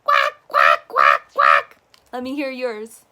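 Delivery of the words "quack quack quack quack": very low, loud duck quack. Shown as a demonstration. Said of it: "quack like a duck?".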